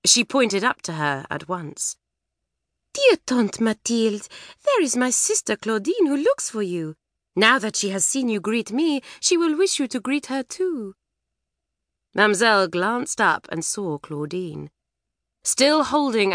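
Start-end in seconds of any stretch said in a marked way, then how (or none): 2.9-10.9 put-on French girl voice. Antoinette speaks.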